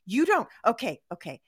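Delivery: normal talking voice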